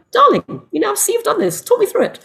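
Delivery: high, coaxing mother voice; calm mother. Acted. Mother sitting her young son down, staying calm. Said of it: "stayed calm, sat him down, went".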